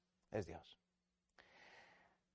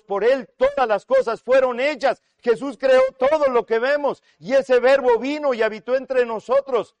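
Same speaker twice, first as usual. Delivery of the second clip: loud, high preaching voice; =fired-up pulpit delivery; impassioned proclamation; 7 semitones higher, 7 dB louder than usual. Real, not acted. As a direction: drive each phrase home at full voice. Preacher proclaims at full force.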